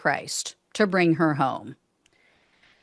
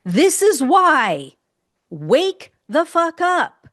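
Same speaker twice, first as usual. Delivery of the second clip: slow, higher, forceful rant; angry; 6 semitones higher, 4 dB louder, slower than usual. Real, not acted. Ranting at uninformed supporters, slow and higher. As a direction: hammer it home to the voters.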